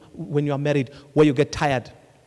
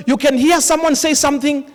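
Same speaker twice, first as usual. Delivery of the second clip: ordinary talk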